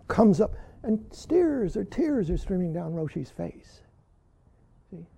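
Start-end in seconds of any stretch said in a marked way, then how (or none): none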